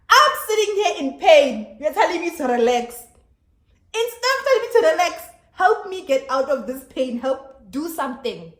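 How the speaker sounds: high, loud exasperated outburst; frustrated. Retold or imitated. Patient in pain snapping at the nurse, frustrated. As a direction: yell it back, fed up and pleading for help. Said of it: "I was hella frustrated".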